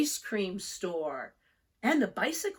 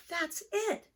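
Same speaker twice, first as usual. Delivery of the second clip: put-on higher eager child voice; a child catching a new idea; Franklin's child voice; 11 semitones higher than usual. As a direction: lift it up eagerly. Franklin thought.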